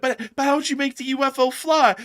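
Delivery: high, loud mock amazement; baffled questioner. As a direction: ask in cartoonish bewilderment. Baffled questioner in unmasking skit.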